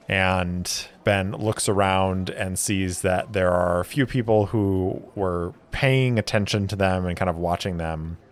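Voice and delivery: normal talking voice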